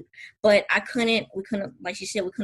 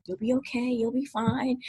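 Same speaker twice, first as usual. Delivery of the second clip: calm reassurance; 3 semitones higher, 5 dB quieter than usual. Retold or imitated. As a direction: talk her down gently, like a calm officiant. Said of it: "Melinda was like".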